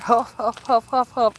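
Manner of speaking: loud puffed huff huff animal noise; imitated huffing. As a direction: mimic the creature's huffing breaths. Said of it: "I just heard".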